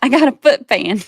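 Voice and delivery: high, loud brag breaking into laughter; amused teasing. Real, not acted. Streamer teases husband about her foot fan, laughing. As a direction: crow it, then crack up.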